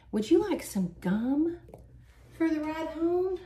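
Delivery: slow, high, drawn-out offer; exaggeratedly slow question, rising high; mock date's offer. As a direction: imitate a date making a leading offer. Acting out date's slow gum offer.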